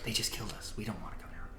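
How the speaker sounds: soft, fast whispering voices; creatures whispering. Acted. GM voicing creatures in the trees, whispering fast. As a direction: hush it like nervous creatures. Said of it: "you hear again, like".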